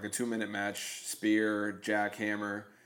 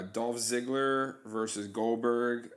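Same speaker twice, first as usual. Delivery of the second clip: usual speaking voice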